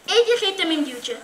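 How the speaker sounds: usual speaking voice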